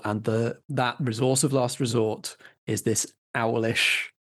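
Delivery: ordinary talk, nothing marked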